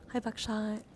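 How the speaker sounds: ordinary talk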